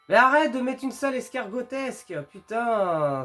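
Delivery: loud frustrated shout; exasperated yell. Real, not acted. Gamer yelling at game.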